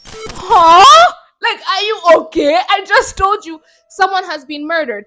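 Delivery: loud, high, disbelieving shout; shocked outrage at fans praising accent over murder; incredulous outburst. Real, not acted. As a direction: yell it in outrage at the comments. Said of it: "outraged reaction to commenters".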